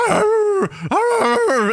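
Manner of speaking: high playful mock growl; dog growl. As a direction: growl like a small angry dog. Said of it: "this dog's going".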